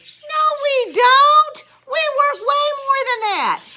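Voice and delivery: outraged cry; indignant outburst. Real, not acted. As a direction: burst out in protest. Angry teacher pay rant.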